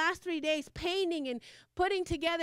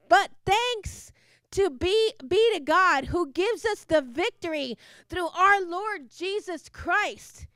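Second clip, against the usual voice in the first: slow, louder scripture reading; scripture proclaimed; 6 dB louder, slower than usual. Real, not acted. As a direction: read the verse as a proclamation. Preacher reading scripture aloud.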